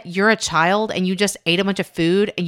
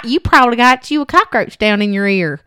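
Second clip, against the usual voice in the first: put-on overdone Southern accent; 4 semitones higher, 6 dB louder than usual. Sister-in-law breaking the news in a hammed-up Southern accent. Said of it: "She's got a Southern accent".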